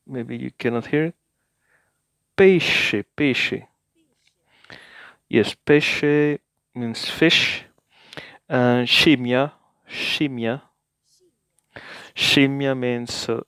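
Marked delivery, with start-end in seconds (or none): 2.4-3.7 "Pesce" said PEH-sheh, the sc as English sh. Shown as a demonstration. Teacher modelling "Pesce" with an sh sound for learners. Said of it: "S C sounds just like English sh". 8.5-10.6 "scimmia" said SHEEM-mya, the sc as English sh. Shown as a demonstration. Teacher modelling "scimmia" for learners, sc as sh. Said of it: "S C sounds just like English sh".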